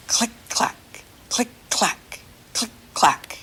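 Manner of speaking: soft breathy click clack like wheels; whispered click clack. Shown as a demonstration. Teacher showing children a trolley's wheel sound, whispered. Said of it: "And they go".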